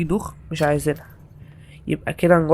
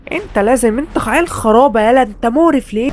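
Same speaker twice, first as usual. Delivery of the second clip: mock scolding; 5 semitones higher than usual. Scolding creature that flipped world.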